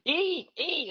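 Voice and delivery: high mock Italian relative urging; sing-song high "eat, eat"; family urging. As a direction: push food on her, high and insistent. Relatives urging food at dinner.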